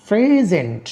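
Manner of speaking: Present with PRE long, high and falling; stress on first syllable. Shown as a demonstration. Teacher giving a word stress example, first syllable of present stressed. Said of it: "সিলেবলের উপরেই করা হয়".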